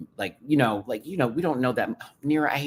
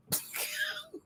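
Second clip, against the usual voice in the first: high squeal laugh; 10 dB quieter than usual. Real, not acted. Laughing burst.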